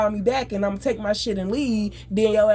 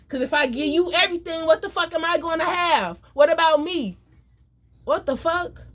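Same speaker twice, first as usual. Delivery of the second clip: exasperated rising outburst; 7 semitones higher than usual. Real, not acted. Heated rant about being taken from.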